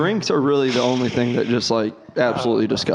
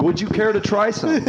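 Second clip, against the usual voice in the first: high mock-posh offer; 8 semitones higher than usual. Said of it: "It's like".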